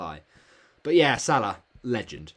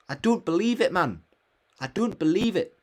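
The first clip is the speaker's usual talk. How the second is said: high, louder I don't believe it; exasperated disbelief; 7 semitones higher, 4 dB louder than usual. Real, not acted. Groaning at viewers over his transfers, in loud high disbelief. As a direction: throw up your hands in disbelief.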